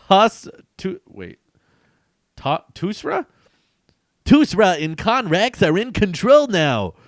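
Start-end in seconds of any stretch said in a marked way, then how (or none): 4.2-7.0 high, loud gloating line; villain boast. Acted. Tusra boasting to the hero, high loud gloat. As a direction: gloat like a comic-book baddie. Reading villain's comic speech.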